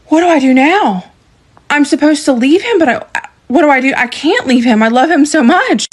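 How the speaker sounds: high, pained pleading; high, anguished questions after being hit; distraught. Retold or imitated. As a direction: torn and desperate, voice lifted high.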